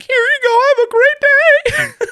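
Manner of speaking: very high, slow strained cheery voice; forced cheerful. Retold or imitated. Handing the kid the balloon, strained high cheer. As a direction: fake customer-service sweetness through pain. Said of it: "I'm like".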